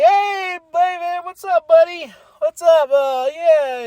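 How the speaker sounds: high, bright show-off greeting at the top of the voice; MJF impression. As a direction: greet like a cocky heel popping out. Imitating MJF popping head out.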